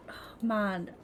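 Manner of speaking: normal talking voice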